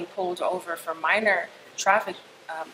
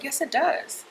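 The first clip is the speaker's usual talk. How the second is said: retold retort, pitched well up; higher, emphatic comeback; insistent contradiction; 9 semitones higher, slower than usual. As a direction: reenact flatly disputing an officer's denial. Retold argument with officer.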